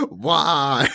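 loud despairing why; anguished why. Retold or imitated. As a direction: cry out to the void. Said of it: "So you're just like".

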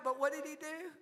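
usual speaking voice

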